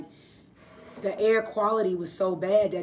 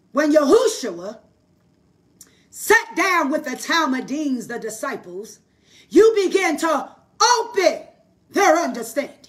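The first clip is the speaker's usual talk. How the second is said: loud, rising preacher's prayer; praying over the listeners, building in power; fervent prayer; 6 semitones higher, 7 dB louder than usual. Acted. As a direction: lift them up in prayer with full force. Prays over the live audience.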